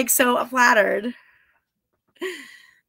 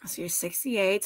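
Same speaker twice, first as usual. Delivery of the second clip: whisper; 3 semitones lower, 11 dB quieter than usual. Real, not acted. Aside reading the viewer's age.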